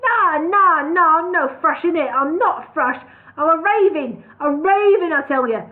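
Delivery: high, loud frantic denial; agitated protest. Acted. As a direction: insist hotly you are a raven. Bird denies being a thrush.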